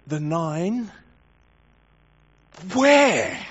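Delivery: incredulous, with a pause before a high, loud WHERE; stunned disbelief. Shown as a demonstration. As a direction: ask it as if you can't believe they're gone. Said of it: "absolute stunned disbelief".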